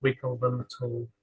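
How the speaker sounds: unhurried body-scan guidance with long pauses; calm meditation guide, leaving space after each phrase; guided meditation. Real, not acted. Leading listeners through a lying-down body scan, guided meditation.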